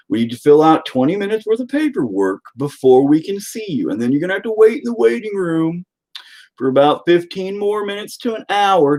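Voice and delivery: hammed-up condescending calm with swooping pitch; mock hospital staff. Staff stalling a patient with bullet hole, mock patronizing calm. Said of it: "they're like".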